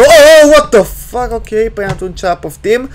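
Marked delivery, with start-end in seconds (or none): 0.0-1.3 high, loud startled cry; alarmed. Real, not acted. Gamer startled while watching the child, alarmed outburst. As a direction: burst out in sudden alarm. Sudden scare in game.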